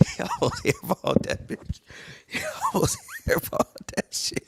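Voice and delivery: words squeaked out in a high pitch through laughter; high laughing squeal. Real, not acted. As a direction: giggle it out in disbelief. Laughing at missed free throw.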